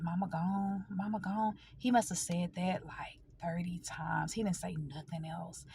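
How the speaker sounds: hushed, fading to a whisper; soft and halting, close to tears; grief-stricken. Real, not acted. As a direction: let the voice drop away in grief. Recalling brother's repeated words, near tears.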